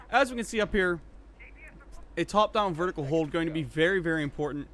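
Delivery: energetic, loud live commentary; esports play-by-play. Acted. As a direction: call the round like a live caster. Casting the round live.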